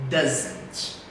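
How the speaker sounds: "Doesn't" said alone for repeating; modelled pronunciation. Shown as a demonstration. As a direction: model "Doesn't" as one word. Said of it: "Let's repeat". ("Doesn't" pronunciado para repetir)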